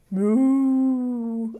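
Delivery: a drawn-out pretend cow moo. Teacher mooing for the children, a playful cow sound.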